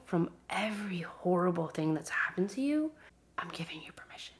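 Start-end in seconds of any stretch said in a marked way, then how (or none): 3.4-4.4 hushed whisper of permission; soft intimate whisper. Real, not acted. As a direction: whisper it as if sharing a secret. Granting permission to heal, intimately.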